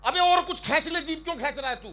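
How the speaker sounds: mocking retort. Real, not acted. Mocking the poet's line.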